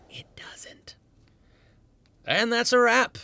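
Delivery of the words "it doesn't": whispered aside. Acted. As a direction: breathe it out in a whisper. Whispered punchline.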